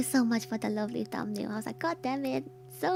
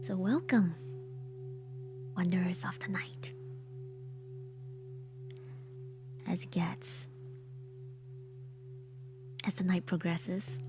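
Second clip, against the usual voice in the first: whispering; 8 semitones lower, 7 dB quieter than usual. Real, not acted. Whispered Halloween stream intro.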